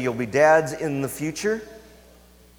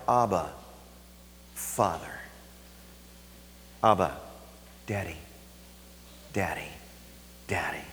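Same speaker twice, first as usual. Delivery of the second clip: fading from soft to a whisper, much lower and softer; tender whisper; 11 semitones lower, 12 dB quieter than usual. Acted. Preacher to congregation, intimate falling whisper. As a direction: breathe each name like a child calling home.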